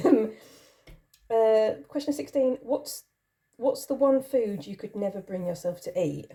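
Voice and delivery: ordinary talk, nothing marked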